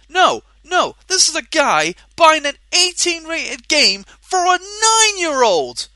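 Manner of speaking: loud, high-pitched exasperated shouting; outraged shout, voice climbing; angry rant. Real, not acted. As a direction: yell it out in real disbelief. Ranting at parent's game purchase.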